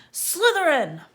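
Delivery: loud, high instant scream; hat screaming. A narrator's reading. Sorting Hat screaming Malfoy's house. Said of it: "it screamed".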